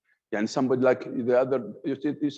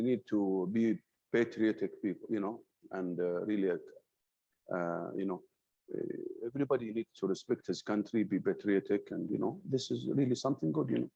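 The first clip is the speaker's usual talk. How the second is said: much softer, low, dull and trailing; passionless tone; 4 semitones lower, 7 dB quieter than usual. Shown as a demonstration. Teacher showing a dull patriotic speech without passion, soft flat mumble. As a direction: mumble it like you don't care at all. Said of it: "If you talk in the tone I previously talked with".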